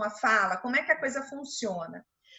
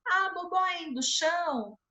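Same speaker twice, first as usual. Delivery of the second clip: put-on higher-pitched adult echoing a toddler's "bobó indo chão"; parroting baby talk; 4 semitones higher than usual. Shown as a demonstration. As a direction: coo the toddler's words back as a question. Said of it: "repetindo a forma como ele fala".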